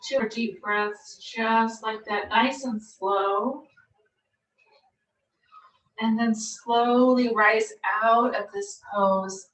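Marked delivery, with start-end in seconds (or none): 0.1-3.6 soft spa whisper. Acted. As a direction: ease them into stillness, unhurried. Guided relaxation in yoga pose.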